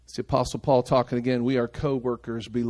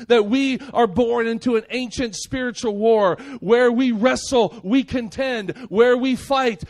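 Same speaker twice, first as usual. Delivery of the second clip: high, punchy sermon delivery; impassioned preaching; 8 semitones higher, 4 dB louder than usual. Acted. Preacher calling the church to spiritual war, impassioned. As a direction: hammer out each short phrase from the pulpit.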